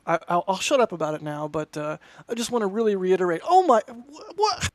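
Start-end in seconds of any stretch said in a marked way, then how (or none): 3.4-4.7 high, loud, startled exclamation; a real burst of surprise, pitched way up; surprised gasp. Real, not acted. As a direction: caught completely off guard by the donation. Sudden hundred dollar super chat.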